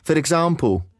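usual speaking voice

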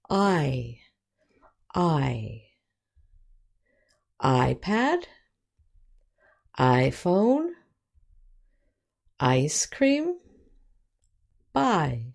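I, iPad, iPhone, ice cream, buy with a long "eye". Shown as a demonstration. Teacher modelling long I and its examples, careful pronunciation. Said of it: "long vowels have the same sound as".

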